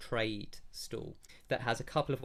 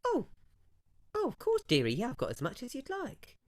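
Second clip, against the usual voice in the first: much higher, fawning trader voice; shopkeeper voice; 10 semitones higher, faster than usual. Acted. Branderi offers makeup to the player, high and cooing. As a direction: coo at the customer like a flamboyant merchant.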